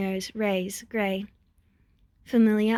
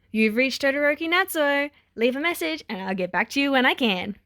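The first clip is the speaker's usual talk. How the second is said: voicemail greeting; 5 semitones higher, 3 dB louder than usual. A narrator's reading.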